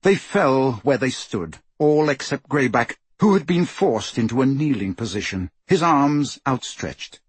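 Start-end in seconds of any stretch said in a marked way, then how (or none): none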